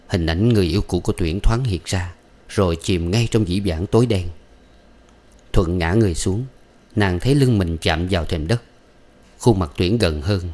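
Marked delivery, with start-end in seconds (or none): none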